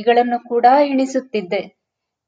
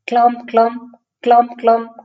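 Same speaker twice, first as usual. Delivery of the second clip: higher, louder stomping march beat; marching footsteps; 4 semitones higher, 4 dB louder, faster than usual. Retold or imitated. Boy listening to soldiers pass by, imitated march. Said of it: "ಸೈನಿಕರ March past".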